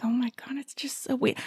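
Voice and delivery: hushed whisper, like a secret confession. Retold or imitated. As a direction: breathe it out as a whisper. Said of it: "I'm like".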